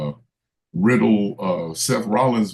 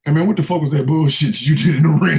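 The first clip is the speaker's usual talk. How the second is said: loud confronting wrestler shout; backstage promo; 6 semitones higher, 6 dB louder than usual. Retold or imitated. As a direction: confront him in the hallway.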